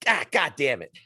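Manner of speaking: hammed-up disgusted cursing, building up; dad cursing. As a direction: ham up a disgusted dad swearing at the smell. Dad sniffing chair, retold.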